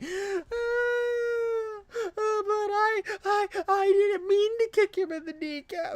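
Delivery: high, whimpering fake sob; mock a crybaby playing the victim, hammed-up; mock crying victim. Mocking a streamer who plays the victim, in a whiny fake-crying voice.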